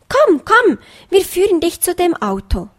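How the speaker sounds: put-on eager children tugging someone along; very excited. A narrator's reading. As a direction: fox cubs leading the bunny to the car, thrilled. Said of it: "sagten die Fuchsenkinder ganz aufgeregt".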